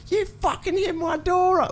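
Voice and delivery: very high shrieking imitation of an angry woman; mock screaming bogan. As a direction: shriek it like an irate bogan lady. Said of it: "starts fucking screaming at me".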